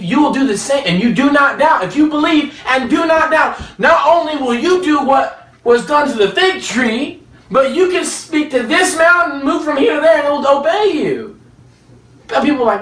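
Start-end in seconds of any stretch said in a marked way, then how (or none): 0.0-11.4 fiery preaching. Real, not acted. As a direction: preach it out with full force. Preaching at full force.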